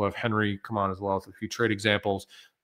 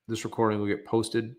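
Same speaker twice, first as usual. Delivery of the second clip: ordinary talk, nothing marked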